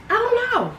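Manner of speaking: I don't know squeaked up very high; squeaky high. Acted. Repeating "I don't know" musingly.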